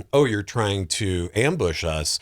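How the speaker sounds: ordinary delivery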